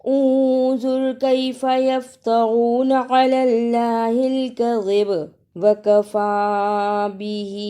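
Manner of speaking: slow melodic tajweed chant; Quran recitation. Acted. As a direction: recite each phrase long and sung. (آہستہ ترنم سے تلاوت)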